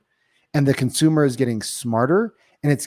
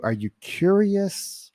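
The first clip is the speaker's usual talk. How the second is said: ordinary talk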